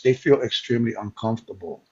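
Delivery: usual speaking voice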